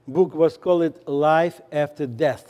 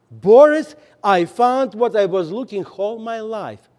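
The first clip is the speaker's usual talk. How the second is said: a higher, louder burst on the name; a wife bursting with joyful news; overjoyed wife; 4 semitones higher, 6 dB louder than usual. Retold or imitated. Wife back from church tells husband, excited.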